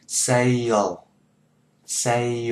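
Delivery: "Sale" in two joined beats, say-o; "Sale" said slowly as two linked syllables; model sale. Shown as a demonstration. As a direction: glide "Sale" from say into a dark o. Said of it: "Cái cách mình phát âm ra sale".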